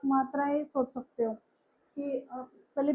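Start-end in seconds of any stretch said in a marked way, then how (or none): none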